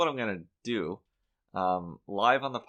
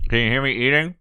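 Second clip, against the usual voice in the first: talking through a bite, louder; chewing while talking; 5 semitones higher, 9 dB louder, faster than usual. Real, not acted. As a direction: mouth full of cookie. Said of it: "now I'm gonna eat live on mic".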